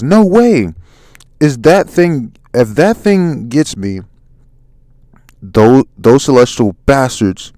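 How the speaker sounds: loud, acted disbelief; alarmed. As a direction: exclaim it in alarm, then slow down. Character alarmed as attackers close in.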